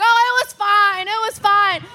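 high imitated brush-off; mock a father waving away worry; put-on dad voice, pitched well up; dismissive dad. Dad brushes off her alarm.

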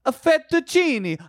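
high, drawn-out cartoon Italian fettuccine; over-the-top Italian accent, slow and high-pitched. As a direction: ham it up as a Looney Tunes Italian. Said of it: "in a racist Italian accent".

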